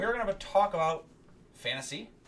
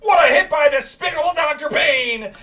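booming play-by-play call, much higher and louder; sports announcer shout; 11 semitones higher, 11 dB louder than usual. Acted. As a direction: yell it like a stadium commentator. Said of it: "That does sound cool when you say it like that".

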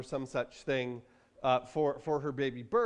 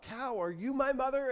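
high, lightly acted chick's question; a hint of a baby bird, pitched up; 9 semitones higher than usual. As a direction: ask like a hopeful lost hatchling. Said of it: "He's like".

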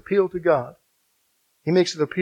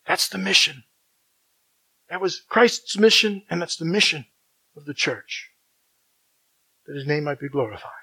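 ordinary delivery

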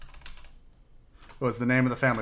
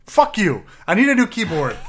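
furious yell, much higher and louder; angry shout; 8 semitones higher, 10 dB louder than usual. Real, not acted. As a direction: blow up at the keyboard. Yelling at a broken keyboard.

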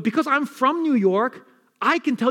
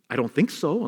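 normal talking voice

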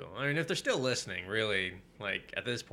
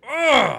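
loud, high groan of exasperation; enraged groan; 17 dB louder than usual. Retold or imitated. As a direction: let out an explosive ugh. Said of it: "I was so enraged".

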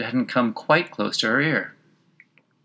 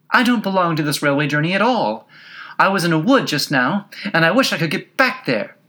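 high, insistent little protest; rather impatient; 7 semitones higher, 4 dB louder than usual. A narrator's reading. Alice protesting about the railway journey, rather impatient. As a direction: protest it, pitched well up. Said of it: "Alice said rather impatiently".

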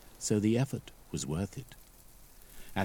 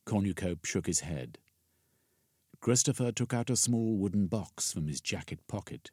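ordinary delivery, nothing marked

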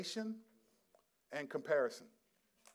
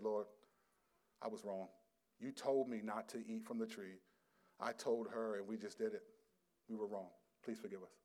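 contrite plea; 4 semitones lower, 8 dB quieter than usual. Pretend. Imagined contrite prayer of Adam.

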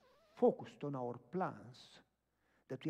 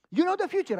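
high, loud mimicked amazement; astonished question; 15 dB louder than usual. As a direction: cry it out as the stunned lady. Astonished woman questions him.

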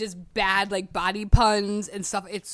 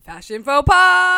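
louder, slow grand title read; dramatic announcement; 5 semitones higher, 12 dB louder, slower than usual. Pretend. Repeating topic title dramatically.

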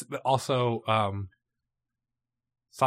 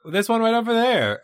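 put-on high, bubbly Valley Girl accent, louder; 10 semitones higher, 7 dB louder than usual. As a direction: chirp it like a California Valley Girl. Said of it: "just a Valley Girl accent".

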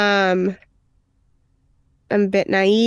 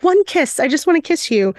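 higher-pitched imitation of a coaxing plea; mock wheedling, pitched up at the start; 7 semitones higher than usual. Armand asking for one kiss, imitated coaxing.

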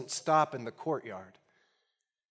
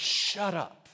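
higher, mildly angry retold outburst; infuriated retort; 6 semitones higher than usual. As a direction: snap it, imitating a man losing his temper. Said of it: "he grows so infuriated".

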